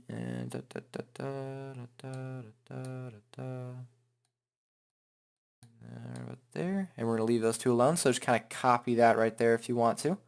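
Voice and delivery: usual speaking voice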